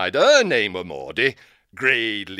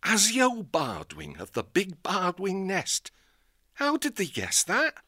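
put-on higher, softer, awed old owl; amazed old owl; 7 semitones higher, 3 dB quieter than usual. Old owl marvelling at the fortune teller. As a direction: marvel at it like a simple old bird.

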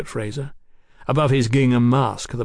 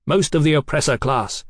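ordinary delivery, nothing marked

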